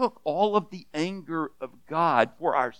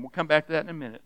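ordinary delivery

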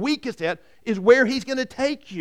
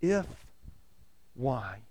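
hushed; 8 semitones lower, 10 dB quieter than usual. Shown as a demonstration. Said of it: "repeating the two words".

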